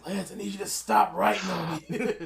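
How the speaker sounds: laughing mock complaint. Co-host voices Jake begging Lance, laughing through it. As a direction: whine while cracking up.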